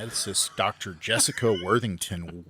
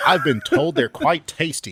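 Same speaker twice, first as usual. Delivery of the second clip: put-on a louder, higher, half-hearted Aussie accent; failed try at sounding Australian, raised pitch; attempted Australian accent; 7 semitones higher, 9 dB louder than usual. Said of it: "Did you do an accent?".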